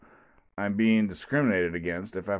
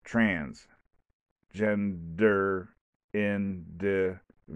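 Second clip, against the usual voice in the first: syllable by syllable, very slow; each syllable of "Transgender" and "individual" spaced apart with a clap; clapped syllables; much slower than usual. Shown as a demonstration. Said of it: "I have to clap".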